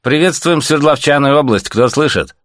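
high, loud broadcast greeting; radio operator calling. A narrator's reading. Operator greeting Sverdlovsk region, radio calling. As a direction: hail a whole region over the air.

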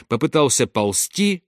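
plain reading voice

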